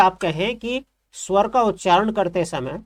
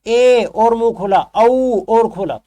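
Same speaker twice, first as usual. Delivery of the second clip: louder vowel demo; 3 semitones higher, 6 dB louder, slower than usual. Shown as a demonstration. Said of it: "आप देखिए सबसे ज्यादा मुंह बंद है".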